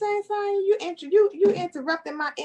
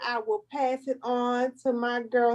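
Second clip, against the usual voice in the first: normal talking voice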